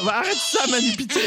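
pleading in a squeal; high mock pleading. Real, not acted. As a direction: beg high and pitiful. Begging to stop sound.

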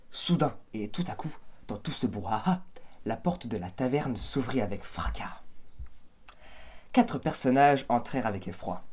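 measured storyteller reading; story narration. A narrator's reading. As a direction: set the tavern scene like a tale. Reads the narration of the scene.

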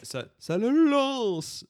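high, loud, drawn-out wail; film character imitation. Fan quoting the toothache scene, film character imitation. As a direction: stretch the word out in mock pain. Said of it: "j'adore celle-là".

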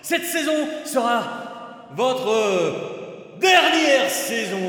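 slow, loud villain threat; menacing villain. Acted. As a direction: drag out the final threat.

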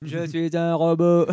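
slow, loud mock robot. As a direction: drag it out like a robot. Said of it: "tu es un rob".

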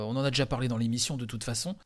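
normal talking voice

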